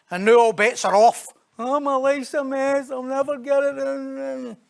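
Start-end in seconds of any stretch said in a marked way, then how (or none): 1.6-4.6 slow, higher whining lament; mock self-pity. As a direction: drag it out like a sorry soul.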